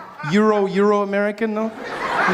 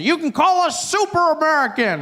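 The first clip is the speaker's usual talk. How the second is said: Southern man calls out; 8 semitones higher than usual. Retold or imitated. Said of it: "a gentleman shouts it out".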